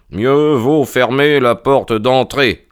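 mild cry of warning, drawn out; exclaimed. A narrator's reading. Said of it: "s'écria Holmes". (exclamation appuyée, légèrement haussée)

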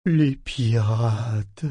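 lent, rêveur, hanté par la peur; traîner les mots comme en rêve; voix rêveuse et terrifiée. A narrator's reading. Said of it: "n'eût été la terreur que sa voix trahissait, le ton semblait rêveur". (ton rêveur teinté de terreur)

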